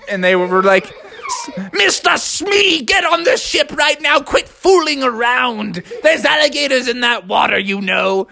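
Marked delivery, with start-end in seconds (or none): 1.7-8.3 scolding captain. A narrator's reading. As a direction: bellow at a fooling-around sailor. Captain scolds sailor in water.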